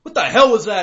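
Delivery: high, loud startled curse; retold angry shout. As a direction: yell it like you just slammed into a pothole. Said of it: "I immediately get pissed off".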